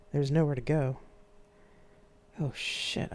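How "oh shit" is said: unvoiced whispered thought; inner thought. A narrator's reading. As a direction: breathe it out as a private thought. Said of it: "I thought".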